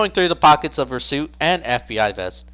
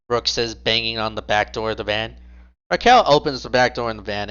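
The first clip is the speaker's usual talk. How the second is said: usual speaking voice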